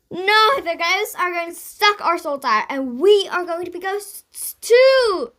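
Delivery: high, loud terrified wail; panicked scream. A narrator's reading. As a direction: scream out the doom. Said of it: "screamed Jane".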